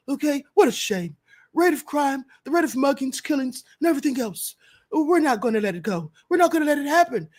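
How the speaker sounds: lightly voiced Trump, rambling then fast; a hint of Trump repeating beautiful; mock Trump. Vlogger reading Trump's promise to viewers, mild mock Trump.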